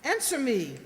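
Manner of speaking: loud, commanding order; sharp demand. Acted. Mother demanding Albert answer her, loud and commanding. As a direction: snap the order at him.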